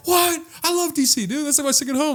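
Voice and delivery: high excited what, loving DC. Retold or imitated. Telling the driver he loves DC, high and excited. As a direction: squeal it up high with delight.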